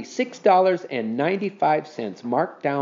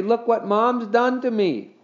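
put-on slow, high boy's wail; 10 semitones higher than usual. A narrator's reading. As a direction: complain to Dad, wailing. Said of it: "wailed Henry".

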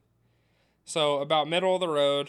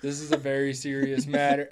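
low, stern, mock formal; mock serious voice; 4 semitones lower, 4 dB quieter than usual. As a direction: fake solemn gravity. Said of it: "all of a sudden being like".

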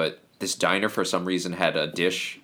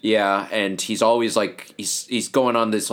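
ordinary delivery

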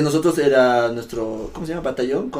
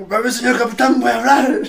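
loud, high military call; recruit reporting; 11 semitones higher, 6 dB louder than usual. Retold or imitated. Recruit asking his captain to speak once more, loud high call. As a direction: sound off to the captain, pitched way up.

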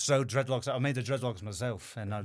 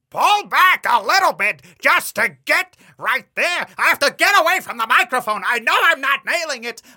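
raspy, much higher and louder stretch voice; Nathan Lane rasp; 20 dB louder than usual. Shown as a demonstration. Voice actor reaching for Gilbert Gottfried via Nathan Lane, raspy high. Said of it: "get up into Nathan Lane".

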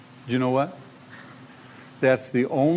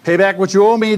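loud, raised-pitch demand; commanding; 6 semitones higher, 9 dB louder than usual. A narrator's reading. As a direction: read it as a forceful command. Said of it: "he commanded".

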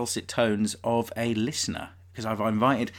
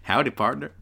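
fake cowboy accent; 4 semitones higher, 4 dB louder than usual. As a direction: put on a Wild West cowboy voice. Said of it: "pretty good American accent".

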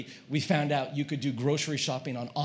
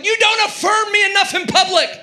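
hammed-up high shout; loud, high mock outburst; yelling; 9 dB louder than usual. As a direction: shout a wounded complaint at wife. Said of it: "I start yelling at Kate".

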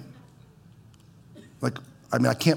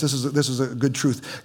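ordinary talk

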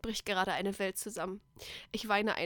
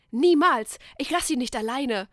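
loud defiant yell, pitch jumping up; roaring refusal; 2 semitones higher, 10 dB louder than usual. A narrator's reading. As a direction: bellow it, refusing to leave him. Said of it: "brülle ich". (laut brüllend, trotzig)

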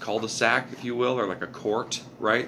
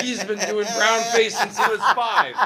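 high-pitched, laughing and louder; laughing mock quote; 13 semitones higher, 5 dB louder than usual. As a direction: laugh through the mock accusation. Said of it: "They're like".

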